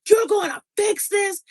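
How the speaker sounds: high mock shout; retold shout. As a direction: yell it like the angry old man. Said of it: "He's screaming and yelling".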